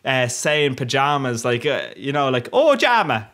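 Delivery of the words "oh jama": put-on a light jokey Irish accent, pitched much higher and louder on "Oh"; hint of an Irish lilt, called out high; mock Irish accent. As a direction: toss off "Oh, Jama" like a playful mock Irishman. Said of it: "reminds me of an Irish person".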